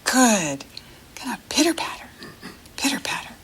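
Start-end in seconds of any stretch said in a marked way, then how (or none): 1.1-3.3 soft, higher whispered pitter patter; whispered rain sound. Shown as a demonstration. Teacher joins kids making rain, whispering. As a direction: patter it softly along with the kids. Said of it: "You try and make a rain sound".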